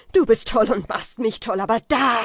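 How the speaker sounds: laut, erregt; wilder Schrei. A narrator's reading. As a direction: schrei ihn wild an. Falke schreit Kaspar an. (wild schreiend)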